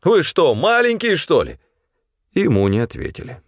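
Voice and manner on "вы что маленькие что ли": high-pitched shouted reproach; exasperated yell. A narrator's reading. Supply man shouting after two companions walking away, exasperated. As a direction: yell after people who won't listen. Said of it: "заорал снабженец".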